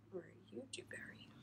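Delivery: whispered question to the yarn; hushed playful whisper. Real, not acted. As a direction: breathe the question, no voice. Asks the second skein in whisper.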